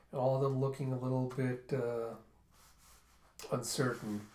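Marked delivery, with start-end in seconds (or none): none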